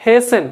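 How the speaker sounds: HASTEN said as HAY-sen; silent T. Shown as a demonstration. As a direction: drop the T in HASTEN. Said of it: "టీ పలకదండి".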